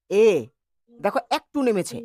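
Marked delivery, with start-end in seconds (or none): 0.1-0.3 এ said alone and held clearly; vowel demo. Shown as a demonstration. Teacher to class, modelling the vowel এ. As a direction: sound out এ on its own. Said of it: "এ উচ্চারণ করো".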